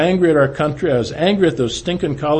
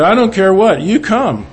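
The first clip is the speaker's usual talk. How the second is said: higher, louder urging; insistent; 7 semitones higher, 6 dB louder than usual. Retold or imitated. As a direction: cut off the excuse and insist. Charlie insisting again.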